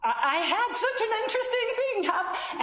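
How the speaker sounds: high, quick, excited teaser; high-pitched, hurried show of excitement; eager conversation bait. Acted. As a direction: dangle the news brightly, pitched well up. Baiting a child with news, repeated.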